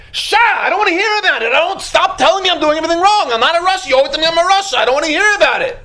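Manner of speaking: high, agitated protest; acted irritated outburst. Sinner argues with his inner warning, mock exasperated.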